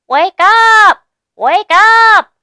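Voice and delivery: high, loud squeaky mouse cry; Mouse shouting awake. A narrator's reading. Mouse yelling at the sleeping animals, high and loud. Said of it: "and she says".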